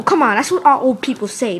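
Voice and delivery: ordinary talk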